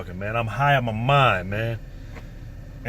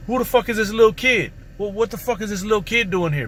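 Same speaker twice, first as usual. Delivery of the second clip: higher, puzzled and blunt; a woman startled to find a kid there; surprised woman; 6 semitones higher than usual. Retold or imitated. Young woman in the living room spotting the boy, surprised.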